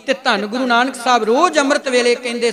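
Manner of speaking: usual speaking voice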